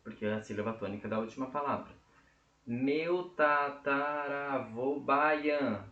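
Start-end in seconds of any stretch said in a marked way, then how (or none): 2.7-5.8 very slow, each syllable held, ending on "an"; syllable-by-syllable counting. Shown as a demonstration. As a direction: measure the verse syllable by syllable. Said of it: "Por que eu contei até o an".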